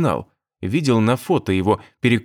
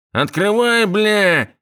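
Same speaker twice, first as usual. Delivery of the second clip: nasal thug shout; 9 semitones higher, much slower than usual. A narrator's reading. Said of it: "раздался гундосый крик".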